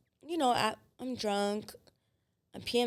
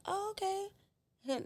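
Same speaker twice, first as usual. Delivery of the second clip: unimpressed oh okay; 10 semitones higher, 7 dB quieter, much slower than usual. Retold or imitated. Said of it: "I'm like".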